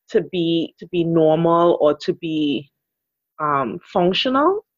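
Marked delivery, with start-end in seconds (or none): none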